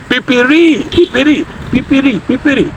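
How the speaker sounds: "pipiri" sung out high, repeated; pipiri demo. Shown as a demonstration. Teacher showing laborers' word "pipiri". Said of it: "pipiri means food".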